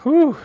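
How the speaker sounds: a sharp, high, loud whew of awe; impressed whew. Real, not acted. Host reacts to the Navy seal standard with an impressed whew. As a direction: blow out a whew as if the bar is high.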